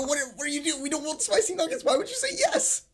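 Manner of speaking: getting shriller with disbelief; exasperated retold yell. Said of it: "And I go".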